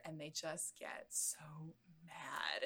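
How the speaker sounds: unvoiced slow whisper. Real, not acted. Telling how the friends get so mad, slow whisper. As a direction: whisper it slowly. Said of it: "whispered line".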